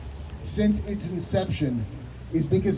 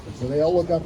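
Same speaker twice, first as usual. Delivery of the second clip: ordinary delivery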